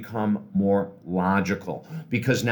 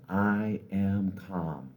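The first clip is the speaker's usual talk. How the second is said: very slow, soft meditation mantra; slow calm affirmation; 7 dB quieter, much slower than usual. Shown as a demonstration. Coach leads the inhale affirmation, slow and calm. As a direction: stretch it out softly and calmly. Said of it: "do it very slowly".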